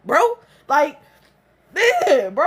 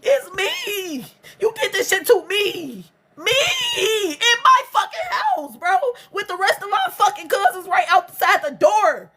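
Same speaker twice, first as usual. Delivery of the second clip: much higher, breaking cry half laugh; 5 semitones higher than usual. Real, not acted. Crying out about the assault at her own house, voice breaking. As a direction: wail it, voice cracking.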